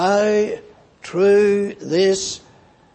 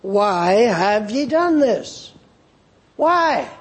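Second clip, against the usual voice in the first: preacher voicing God's demand for an answer; God's pressing question; 2 semitones higher, slower than usual. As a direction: press it home, rising on the last why. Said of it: "God still asks the question".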